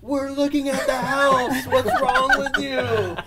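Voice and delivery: high, loud, drawn-out indignant holler; retold yelling. Agent retelling confronting the old man, high imitated yelling. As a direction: holler it slowly so he finally hears. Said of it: "yell in his face".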